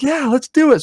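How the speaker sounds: high, eager let's do it; thrilled to go; eager yes. Retold or imitated. Kid agrees to fly, high and eager. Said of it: "I'm like".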